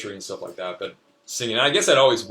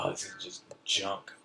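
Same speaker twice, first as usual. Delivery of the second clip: disgusted dismissal; 4 semitones lower, 12 dB quieter than usual. Retold or imitated. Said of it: "I was like".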